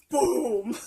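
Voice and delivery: loud, explosive sound effect; sudden loud boom. Retold or imitated. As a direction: punch it out as a loud burst. Acting out the high suddenly hitting.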